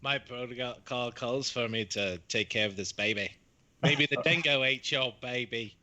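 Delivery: put-on a loose New Zealand accent that drifts toward Scottish; mock Kiwi robot. Host imitates the nurse droid in a drifting accent. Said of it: "They got a little Scottish at moments".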